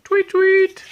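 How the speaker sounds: very high tweet-tweet like a whistle; police whistle. Retold or imitated. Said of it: "blowing his whistle".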